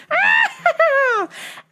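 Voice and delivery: long high shrieking cackle; manic cackle. Retold or imitated. She comes in the store, manic high cackle. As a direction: mimic a crazed, shrieking laugh. Imitates woman in traffic.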